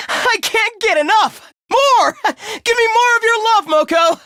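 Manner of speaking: high-pitched, frenzied pleading; ecstatic begging. Acted. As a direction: beg rapturously, voice shooting up.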